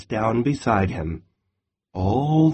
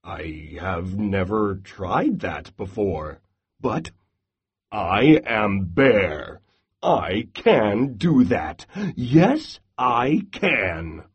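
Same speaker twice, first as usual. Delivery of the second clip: low, slow, puffed-up bear voice; bragging Bear; 4 semitones lower than usual. Acted. Bear brags about being biggest, strongest, low and slow. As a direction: boom it out like a proud big bear.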